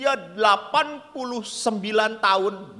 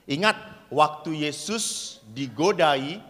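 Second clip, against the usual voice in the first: ordinary delivery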